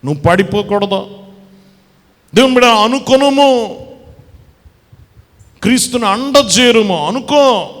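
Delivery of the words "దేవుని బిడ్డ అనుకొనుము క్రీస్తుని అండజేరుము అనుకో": loud, ringing preacher's call, slowing on the last words; preaching at full force. Acted. Preacher calling out loudly to the congregation about the sick woman.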